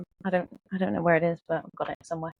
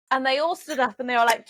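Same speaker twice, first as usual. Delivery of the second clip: ordinary talk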